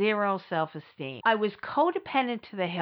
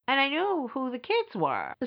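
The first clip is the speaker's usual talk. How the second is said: usual speaking voice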